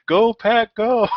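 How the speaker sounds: high, loud chanted taunt; hammed-up fan chant; mock cheer. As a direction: cheer it at her to tease. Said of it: "He's like".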